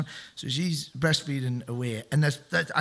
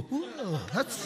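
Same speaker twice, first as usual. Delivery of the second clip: mock startled whoa; 7 semitones higher, 5 dB quieter than usual. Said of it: "And you go".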